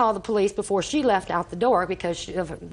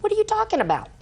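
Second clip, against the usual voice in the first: incredulous retort; 12 semitones higher, faster than usual. Retold or imitated. Grandma retorts to angry caller.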